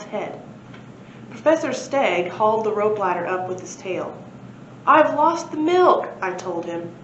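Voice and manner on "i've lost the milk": dismayed. A narrator's reading. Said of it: "narrator voicing dad's dismay".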